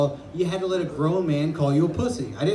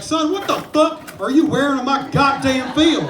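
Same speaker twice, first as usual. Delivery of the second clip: mock coach yell; 6 semitones higher, 4 dB louder than usual. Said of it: "a grown man yelled at me".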